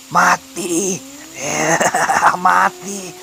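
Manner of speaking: high chant broken by laughter; eerie, high laughing threat; possessed cackling. A narrator's reading. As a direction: cackle through the word like one possessed.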